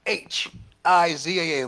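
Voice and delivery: letters called out one at a time, loud; spelling out loud. Shown as a demonstration. Preacher spells a hard word for everybody, emphatic spelling. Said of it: "Want me to spell it for everybody".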